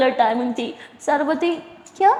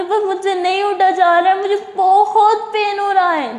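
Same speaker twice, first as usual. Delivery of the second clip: higher, louder teary complaint; tearful pain; 7 semitones higher, 5 dB louder than usual. Acted. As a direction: whine through the pain. Sara sobbing in pain.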